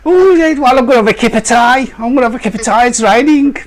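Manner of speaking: high, hammed-up Yam Yam voice.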